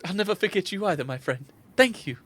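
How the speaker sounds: high, tender character voice; daughter's fond farewell. Acted. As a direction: thank a dear friend in parting. Daughter's farewell to her friend.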